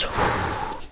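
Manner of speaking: relieved whew. Acted. Breathing out after burning bodies.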